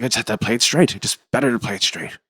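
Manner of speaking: lightly imitated Irish accent; offhand answer in a mild Irish lilt. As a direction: put on a hint of an Irish accent. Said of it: "in his Irish accent".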